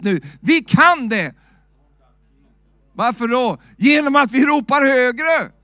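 loud, high preaching at full force; loud pulpit preaching; fiery preaching. Acted. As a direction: preach it out, shouting to the congregation.

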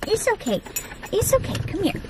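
calm the scared puppy gently; soothing the puppy. Real, not acted.

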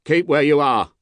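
high, loud order across a distance; guard calling out. A narrator's reading. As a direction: call a warning to halt. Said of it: "the guard called to the voice".

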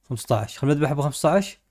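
ordinary talk, nothing marked

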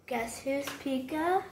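usual speaking voice

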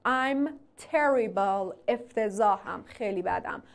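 ordinary talk

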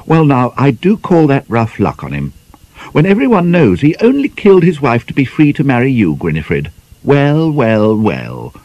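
sly schoolgirl teasing her friend; teasing young girl. Acted.

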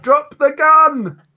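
high, loud, drawn-out yell; in-character shout. Pretend. Player shouts to the fleeing character from the doorway, loud shout. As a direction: yell it long and high to someone running. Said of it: "I'm gonna shout".

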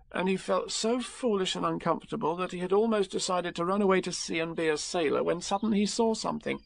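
ordinary delivery

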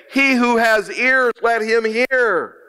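high, ringing proclamation, slowing at the end; emphatic exhortation. Acted. Quoting the Lord's exhortation.

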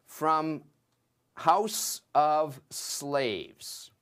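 "house of slaves", very slow, with weight on house; slow, weighty. Shown as a demonstration. Teacher lingers on "house of slaves" for his class, slow and weighty. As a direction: drag out "house of slaves" heavily. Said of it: "we can put that into English idiom".

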